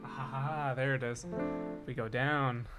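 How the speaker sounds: ordinary delivery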